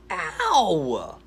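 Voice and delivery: cartoon ow. Gator hitting his head on a rock, high yelp. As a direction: yelp as you bump your head. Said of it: "Nathan screams".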